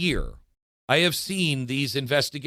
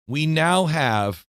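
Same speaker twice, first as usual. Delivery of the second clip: usual speaking voice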